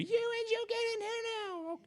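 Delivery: very high, slow hollering mom; mock scream from the house. Mom screaming from the house at her boys, high and slow. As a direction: holler for the kids out in the barn. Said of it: "scream at us from the house".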